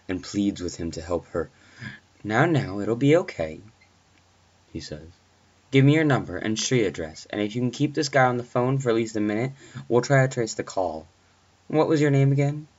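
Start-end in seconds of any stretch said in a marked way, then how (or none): 2.2-3.6 reassuring dispatcher. Acted. Dispatcher soothes panicking babysitter. 5.7-12.7 higher, louder, brisk official voice; businesslike dispatcher. Acted. Dispatcher asking a frightened babysitter for details, brisk and businesslike.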